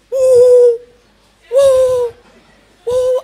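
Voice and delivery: high, loud hooting woo woo woo; imitating a horn. Comic retelling a matatu horn, loud mock hooting. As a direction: mimic a matatu's train-like horn.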